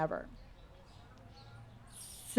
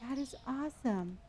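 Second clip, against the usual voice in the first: much softer, hushed; 14 dB quieter than usual. Shown as a demonstration. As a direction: say it quietly this time. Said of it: "Oh, I'm sorry".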